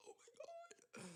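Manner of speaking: high whispered gasp of delight; excited whisper. Real, not acted. Asked her favorite song, a high excited whisper. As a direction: whisper it like the question thrilled you.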